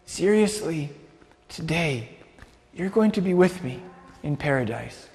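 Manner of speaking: tender and hushed, with pauses; gentle reassurance. Acted. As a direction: reassure a dying man quietly, phrase by phrase. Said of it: "which is like him saying".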